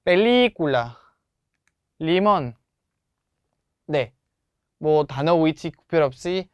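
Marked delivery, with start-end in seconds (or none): 0.0-2.5 펠리쿨라 leaning on 리, 리몬 on its accented 리; syllable stress. Shown as a demonstration. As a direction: lift and push 리 in 펠리쿨라 and 리몬. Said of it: "강세는 다 완벽하게 합니다". (펠리쿨라와 리몬의 '리'에 강세)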